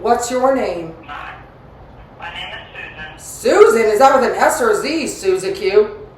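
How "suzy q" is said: ordinary delivery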